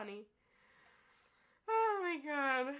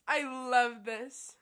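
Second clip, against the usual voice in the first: I love this said slow and much louder, leaning on I; 9 dB louder, slower than usual. Real, not acted. Player gloating at Dia's turn, loud slow glee. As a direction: belt out I love this slowly and gleefully. Said twice, differently.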